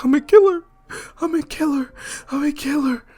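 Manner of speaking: high, tearful falsetto; hammed-up weeping kid voice; crying, terrified. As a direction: repeat it in horrified sobbing disbelief, starting loud. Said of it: "just crying and terrified".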